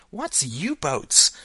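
put-on high, loud little-girl question; slow, high child's voice, puzzled; child character. A narrator's reading. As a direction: ask it as an inquisitive small daughter. Young Alice asks again.

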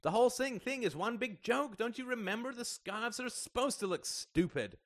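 very high, frantic exasperation; crying out. A narrator's reading. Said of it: "cried Ian".

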